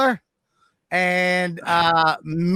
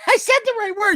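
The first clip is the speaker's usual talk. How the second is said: indignant protest; 12 semitones higher, faster than usual. Real, not acted. Contestant protests the ruling.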